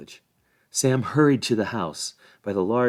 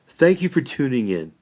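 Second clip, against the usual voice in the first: ordinary delivery, nothing marked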